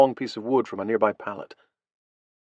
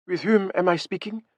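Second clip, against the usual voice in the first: put-on higher-pitched woman with a French accent; heavy French accent; 8 semitones higher than usual. A narrator's reading. French commander answering on the radio, heavy French accent. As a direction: ask it warily in a French accent. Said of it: "in a heavy French accent".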